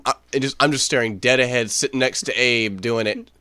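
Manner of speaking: normal talking voice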